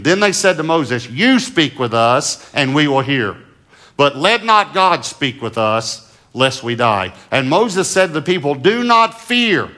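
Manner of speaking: loud, slow, lifted scripture reading; preaching scripture. Acted. Pastor reads Exodus to the church, ringing and slow. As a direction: proclaim the verse to the congregation.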